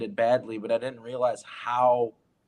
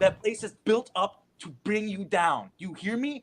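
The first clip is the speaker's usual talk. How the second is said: a hint of an urgent older actor, higher; urgent warning; 6 semitones higher than usual. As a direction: press the warning home, lightly voiced. Mentor urgently warning the kid.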